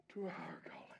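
hushed close after the preaching; sudden whisper. Real, not acted. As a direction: drop to a hush after the shout. Sudden drop after shouting.

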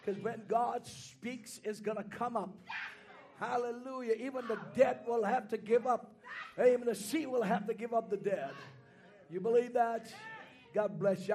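preacher calls over the organ; preaching. Acted. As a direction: keep preaching with energy.

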